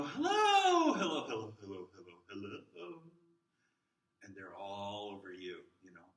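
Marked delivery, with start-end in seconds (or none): 0.0-3.0 very high, loud sing-song greeting that falls away; big bubbly hello to toddlers; gushing preschool hello. Shown as a demonstration. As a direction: greet little kids like they're the best thing all day. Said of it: "it doesn't quite have the same effect".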